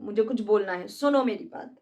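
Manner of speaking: ordinary talk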